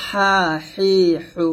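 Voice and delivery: slow squeezed হা হি হু; pressed narrow ha. Shown as a demonstration. Teacher showing where pressure goes, pressed narrow ha. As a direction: press thin air from mid-throat on হা হি হু. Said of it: "এটা চাপ দেবো".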